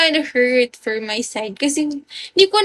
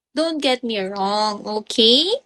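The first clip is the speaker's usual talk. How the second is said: usual speaking voice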